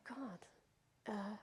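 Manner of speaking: hushed, whispered dread; frightened whisper. Retold or imitated. Victim in the cab reacting to the robbers, retold frightened whisper. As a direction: whisper it under your breath, reliving fear.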